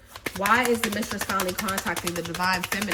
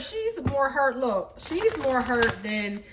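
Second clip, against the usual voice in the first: ordinary delivery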